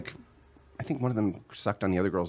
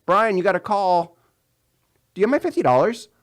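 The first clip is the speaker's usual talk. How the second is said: mock nagging caller; 9 semitones higher, 6 dB louder than usual. Coworker then ex on phone.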